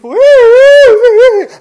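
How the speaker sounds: very high, long, loud wail; mock-hysterical drawn-out shriek; panicked scream. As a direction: imitate a wife shrieking back. Retelling the frantic wife's scream.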